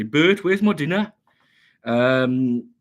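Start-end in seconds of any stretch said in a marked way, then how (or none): 0.0-1.1 put-on louder, higher comic character accent; hammed-up cartoon catchphrase. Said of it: "Do you want me to do it ... in accent".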